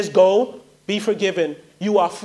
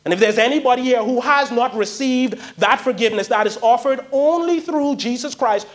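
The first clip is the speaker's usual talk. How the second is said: ordinary talk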